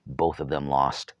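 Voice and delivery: plain reading voice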